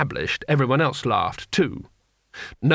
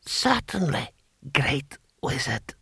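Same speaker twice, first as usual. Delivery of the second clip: put-on soft, slow old ruler; halting, feeble imperial voice; ancient emperor; 4 dB quieter, slower than usual. Emperor granting the wizard's request, soft halting voice.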